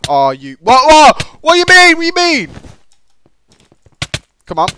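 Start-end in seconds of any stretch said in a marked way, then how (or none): 0.6-2.5 very high, loud disbelieving shout; outraged yell. Real, not acted. Gamer protesting a setback in the game, outraged yell.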